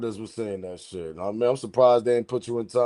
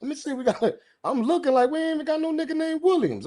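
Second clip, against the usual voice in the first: high mock search; 13 semitones higher than usual. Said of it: "I'm like".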